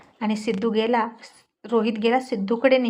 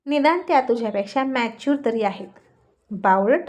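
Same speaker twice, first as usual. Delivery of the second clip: plain reading voice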